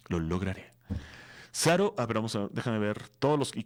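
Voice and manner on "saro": ordinary delivery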